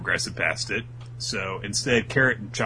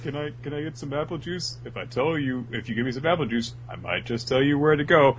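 wheedling dude; 6 semitones higher, faster than usual. Acted. Dude bargaining for juice.

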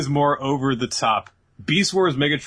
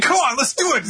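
eager; 13 semitones higher, 4 dB louder, faster than usual. Retold or imitated. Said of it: "He was like".

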